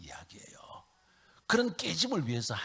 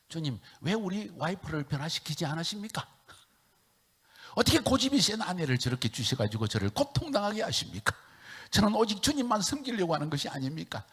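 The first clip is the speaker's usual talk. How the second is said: higher, pleading complaint to God; aggrieved prayer; 8 semitones higher, 5 dB louder than usual. Retold or imitated. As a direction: plead as if wronged. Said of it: "너무 억울해서 울음이 나오더라고".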